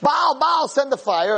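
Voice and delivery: mild mock screaming. False prophets calling to Baal for fire, mild mock screaming. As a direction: imitate desperate worshippers without a full yell. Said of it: "So the Nevi'e Baal are screaming".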